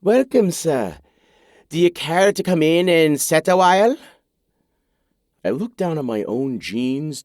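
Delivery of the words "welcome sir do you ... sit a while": hammed-up Scottish brogue, higher and louder; a woman doing a bad Scottish accent for fun; playfully awful Scottish welcome; terrible Scottish accent. A narrator's reading. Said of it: "speaks with the worst Scottish accent I've ever heard".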